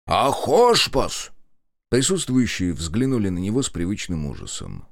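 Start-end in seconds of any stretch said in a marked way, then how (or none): 0.0-1.3 very slow, drawn-out roar; long goal yell. Acted. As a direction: bellow it long like a war cry. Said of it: "страшно кричал Кондрат".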